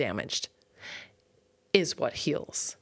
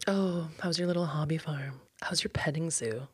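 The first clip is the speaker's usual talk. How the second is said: unvoiced, belittling whisper; condescending whisper; 5 dB quieter than usual. A visitor belittling her working farm, in a snide whisper. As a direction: breathe the question out to belittle. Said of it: "someone to come in and be like".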